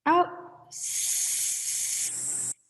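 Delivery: long hissing S breath out; exhale on S. Shown as a demonstration. Voice teacher demonstrating breathing out on S. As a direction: let the air out on a steady S. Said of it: "out on an S".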